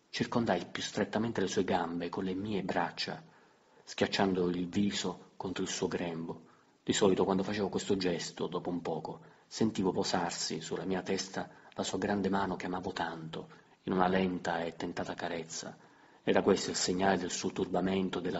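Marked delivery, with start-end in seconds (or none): none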